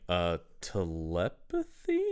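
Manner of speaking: soft, slow, hesitant guess; low, tentative question; baffled questioning. Retold or imitated. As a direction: draw it out in mock bewilderment. Voicing own baffled reaction.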